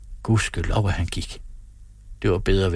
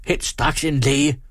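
slow, higher, forceful order; urgent command; 6 semitones higher, 3 dB louder, slower than usual. A narrator's reading. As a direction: demand it, each word weighted. Mother commands doctor. (bydende ordre)

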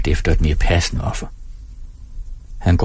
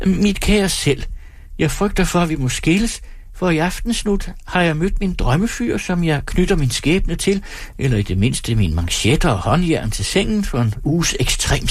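high, theatrical mock confession; hammed-up farewell letter, pitched up; mocking performer as letter-writer; 9 semitones higher than usual. Performer invents the woman's note to herself, high mock-earnest.

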